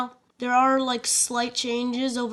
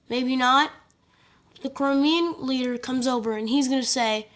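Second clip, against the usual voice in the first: ordinary talk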